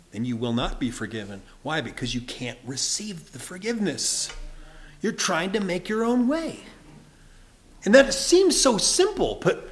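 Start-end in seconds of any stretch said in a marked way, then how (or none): none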